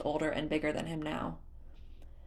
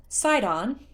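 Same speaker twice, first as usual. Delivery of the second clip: high, loud chiding of a name; sighed reproach; 6 semitones higher, 7 dB louder than usual. A narrator's reading. Sister scolds the child over hair pulling, sighed reproach. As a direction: draw the name out in exasperation. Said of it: "Mipha sighed".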